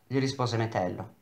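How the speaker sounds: ordinary delivery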